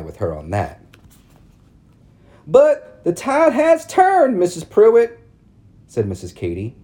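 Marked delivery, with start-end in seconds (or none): 2.5-5.2 high, loud prissy society matron; prim town lady. A narrator's reading. Narrator voicing Missus Cady calling on Mama, high and prim. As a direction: lift it up into a fussy church lady. Ladies voiced in read story.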